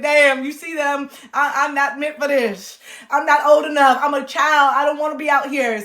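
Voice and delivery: high, loud sassy rant; laughing, loud mock annoyance; sassy exasperation. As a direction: tell him off, read the room.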